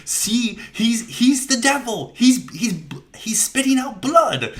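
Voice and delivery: high, loud, stammering mock outburst; frantic accusation. Duncan denouncing Bella as the devil at church, mock-frantic. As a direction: accuse in a hammed-up, high-pitched panic. Acting out Ruffalo's church rant.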